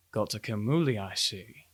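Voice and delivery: low, softened officer's voice; guard chief appraising captive. Acted. Guard chief looking down at small captive, low appraisal. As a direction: drop low and quiet as you look over a prisoner.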